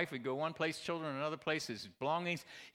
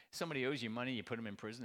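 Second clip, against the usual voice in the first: ordinary talk, nothing marked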